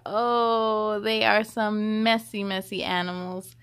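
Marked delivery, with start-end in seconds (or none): none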